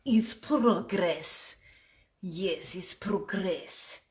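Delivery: put-on slow, wannabe Russian accent; badly faked Russian, drawn out; terrible Russian accent. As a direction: do a bad mock Russian accent. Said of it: "Russian accent is terrible".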